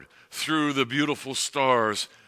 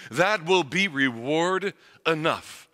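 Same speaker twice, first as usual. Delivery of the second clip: ordinary talk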